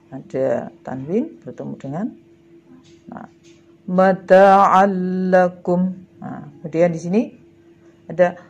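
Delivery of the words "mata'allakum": Mata'allakum loud and drawn out, long doubled l; merged reading. Shown as a demonstration. Teacher gives pupils another idgham example, merged recitation. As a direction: recite Mata'allakum slowly, holding the l with no hum. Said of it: "cara membacanya adalah lebur tanpa dengung".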